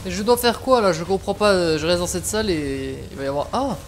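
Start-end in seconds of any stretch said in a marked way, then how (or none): none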